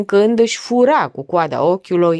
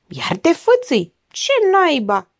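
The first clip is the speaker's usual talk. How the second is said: higher-pitched, irritated complaint; irritated grumble; 9 semitones higher than usual. A narrator's reading. Lawyer grumbling at his sister, irritated. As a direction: grumble with rising exasperation. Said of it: "mormăi iritat".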